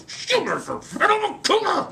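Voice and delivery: put-on squeaky kid voice straining to be tough; a small boy faking toughness, high; high squeaky would-be tough kid; trying to sound tough. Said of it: "And I wanna be tough".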